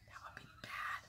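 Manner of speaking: whispered, almost no voice; real frightened whisper; scared whisper. Real, not acted. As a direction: breathe it out in fear. Fearing she cannot work.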